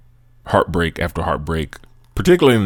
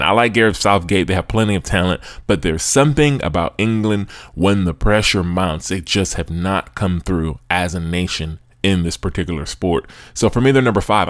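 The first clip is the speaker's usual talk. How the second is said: normal talking voice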